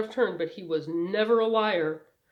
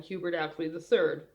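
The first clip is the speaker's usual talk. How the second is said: ordinary delivery, nothing marked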